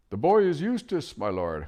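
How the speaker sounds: high, loud hoot; hooted loudly. Acted. Owl loudly correcting the deaf dwarf. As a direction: hoot it at full volume. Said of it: "hooted the owl as loudly as it could".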